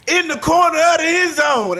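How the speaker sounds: retold loud, high-pitched repeat; exasperated repeat. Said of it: "She repeated what the hell she had just said".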